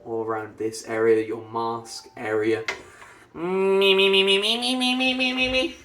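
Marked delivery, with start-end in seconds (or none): 3.3-5.8 high nasal me-me siren; nasal resonance. Shown as a demonstration. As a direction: push it forward into the mask. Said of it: "falsetto nasal one".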